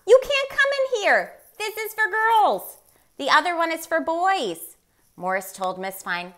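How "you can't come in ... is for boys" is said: put-on high, loud indignant girl's shout; a girl yelling high and loud at the moose in the doorway; girl crying out. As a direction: shriek it to stop him. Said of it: "A girl cried".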